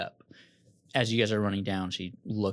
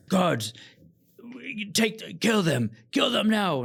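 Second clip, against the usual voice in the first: rising, frantic order, much higher and louder; shouting for the guards; 12 semitones higher, 5 dB louder, slower than usual. Acted. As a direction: scream the order to kill. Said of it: "starts calling for the guards to execute you".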